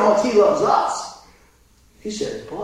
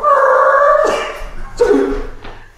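long, high car-sound imitation; imitated car noise; 3 dB louder than usual. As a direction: hold a high vehicle noise. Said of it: "I see this car go".

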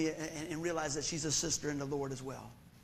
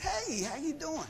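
high, drawn-out friendly hello; friendly greeting; 9 semitones higher, slower than usual. Retold or imitated. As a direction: re-enact a sing-out greeting to a stranger. Greeting the older lady in story.

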